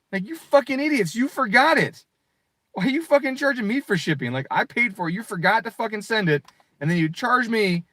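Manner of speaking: high, loud, exasperated ranting; irritated rant. Real, not acted. Streamer ranting at the company over shipping charge, angry. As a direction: vent at the idiots who charged shipping. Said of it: "Oh, I was so fucking irritated".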